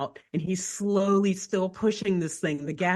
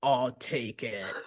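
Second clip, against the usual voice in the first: much lower, slow, quiet rumble; deep baritone; 7 semitones lower, 6 dB quieter, slower than usual. Retold or imitated. Big Henry volunteers to the miners, deep baritone. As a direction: drop into a deep calm giant's voice. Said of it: "in a very baritone, deep voice".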